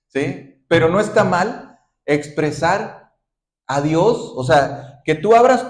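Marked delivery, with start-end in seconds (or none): none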